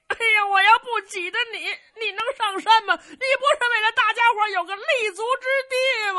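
high, wailing fake sobs over a sworn brother; mock wailing lament. Cheng Yaojin fake-mourning his brother at the shrine, wailing high. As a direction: ham up the grief, crying it out loud. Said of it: "我哭你一场吧".

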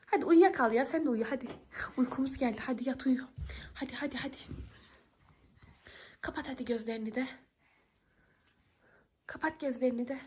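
low, very soft lulling voice; hushed coaxing. Acted. Girl lulls Ayşe Reva to sleep, hushed coaxing. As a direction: coax a little one to sleep in a near whisper.

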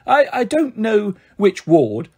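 slow, halting put-on adult voice; a hesitant girl straining to sound grown up; faked grown-up voice. Girl on the phone to the hospital, slowly faking adulthood. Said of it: "I spoke so deep down in my chest".

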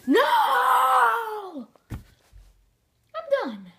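loud high despairing shout; frustrated shout. Real, not acted. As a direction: give up with a yell. Losing, quitting.

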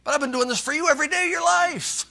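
put-on jealous older son. Older son's jealous complaint.

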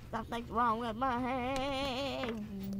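Something's wrong with my head stretched out slowly at normal pitch; slow drawn-out take. Pretend. Gamer joking about his character's head, slow drawn-out delivery. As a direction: drag every word out, slow and heavy. Repeats line in new voices.

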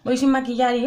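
ordinary talk

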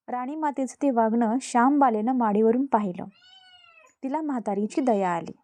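ordinary delivery, nothing marked

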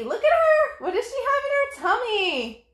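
high, bright call to look; excited pointing. Real, not acted. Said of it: "excited prompt to kids".

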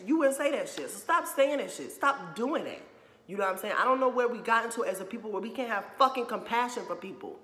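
getting worked up, nearly yelling; angry. Real, not acted. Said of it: "Let me relax".

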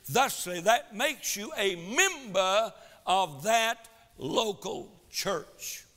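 emphatic pulpit delivery, swelling on key words; forceful preaching. Real, not acted. Preacher pressing the congregation about baptism and church membership, forceful preaching.

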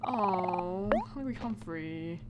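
normal talking voice

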